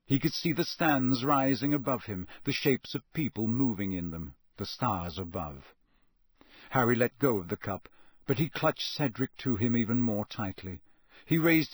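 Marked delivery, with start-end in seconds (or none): none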